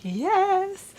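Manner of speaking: high, bright burst of yes; excited yes. Retold or imitated. Vlogger reacting to the reunion, excited yes. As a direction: squeal the yes out. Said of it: "I'm just like".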